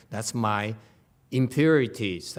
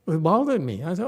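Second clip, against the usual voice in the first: ordinary talk, nothing marked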